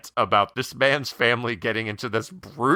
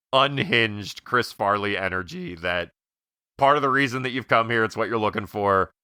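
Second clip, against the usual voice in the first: ordinary talk, nothing marked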